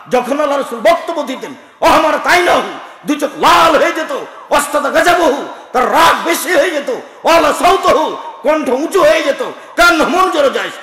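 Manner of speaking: loud, high sermon shout; full-force preaching. Acted. Preacher describing the Prophet's sermons, shouting. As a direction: thunder it to the crowd.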